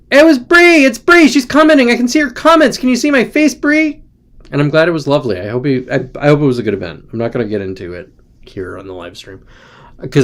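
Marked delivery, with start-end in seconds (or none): 0.1-4.0 excited high voice. Real, not acted. Excitedly greeting Bree on stream.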